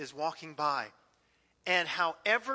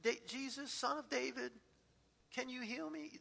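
soft retold cry; 5 semitones higher, 8 dB quieter than usual. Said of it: "You started crying out".

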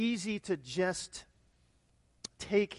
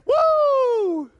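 loud soaring woo; high excited whoop; 12 dB louder than usual. Acted. Pastor shows the graph rising with a loud high whoop. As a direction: whoop as the line shoots up.

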